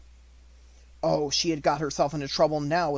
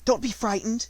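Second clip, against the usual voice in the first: higher, gentle reassurance; reassuring; 8 semitones higher, 4 dB louder than usual. Acted. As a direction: soothe a scared child. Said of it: "as if speaking to a little girl".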